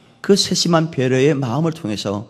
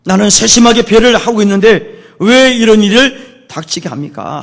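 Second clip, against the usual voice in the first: loud, high protest, easing at the very end; complaining; 10 semitones higher, 11 dB louder than usual. Acted. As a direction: demand to know why, as a hurt believer. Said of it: "그렇게 불평할 때가".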